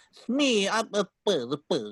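high start sliding down into bumbling; stammering cartoon henchman voice; Smee. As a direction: imitate a bumbling cartoon sidekick. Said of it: "he has that voice".

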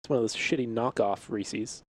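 ordinary talk, nothing marked

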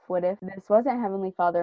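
ordinary talk, nothing marked

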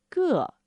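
个 with a falling fourth tone; 个 said as a falling-tone model. Shown as a demonstration. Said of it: "the fourth tone".

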